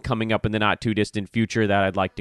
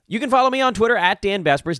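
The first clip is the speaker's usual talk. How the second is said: ordinary talk, nothing marked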